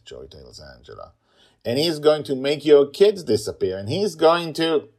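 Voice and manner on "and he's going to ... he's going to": put-on loud, higher child threat; threatening. Girl threatening her aunt, loud.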